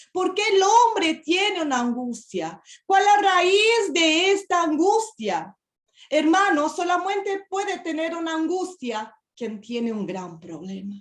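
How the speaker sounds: preacher pressing the congregation with mounting questions, fervent and loud; fervent preaching. Acted.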